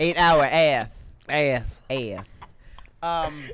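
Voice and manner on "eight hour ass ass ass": lisped "ass" with a th at the end; mock lisp on "ass", pitched low; Mike Tyson. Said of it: "am I supposed to sound like Mike Tyson, like I have a lisp".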